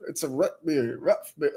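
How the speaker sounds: "root" and "Rough" short, like ruff; mock Midwest vowel. Husband mocking wife's short-vowel root beer. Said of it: "You sound like a dog barking".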